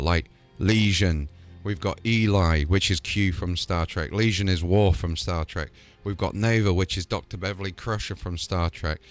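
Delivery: ordinary talk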